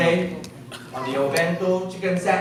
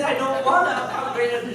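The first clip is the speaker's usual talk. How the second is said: laughing protest; 6 semitones higher than usual. Retold or imitated. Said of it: "I said".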